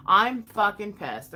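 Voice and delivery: slow, loud, emphatic anger; real anger. Real, not acted. Woman insisting to viewers she's fed up, angry. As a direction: punch each word out slowly and angrily.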